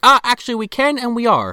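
high, loud, gloating correction; mock villain retort. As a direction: imitate a villain cheerfully overruling a protest. Retold villain's retort to Bob.

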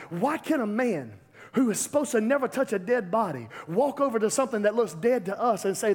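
normal talking voice

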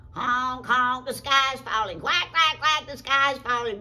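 loud, high bird alarm; honking, quacking birds. Acted. Goose and duck warn the turkey, squawking. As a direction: squawk the news like panicked fowl. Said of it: "They said".

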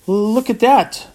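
high, slow, horrified quaver; aghast quavering. Acted. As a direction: voice trembling with horror. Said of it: "I quavered".

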